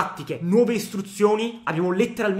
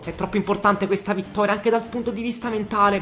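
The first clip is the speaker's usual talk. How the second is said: usual speaking voice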